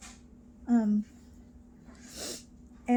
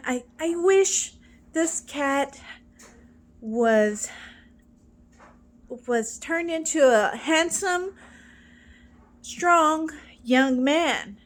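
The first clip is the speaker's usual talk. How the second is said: put-on higher, louder, slowing old lady wish; mock old lady; 4 semitones higher, 5 dB louder than usual. Old lady wishing her cat into a man, mock pleading. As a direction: ham up the old lady's dreamy last wish, slow at the end.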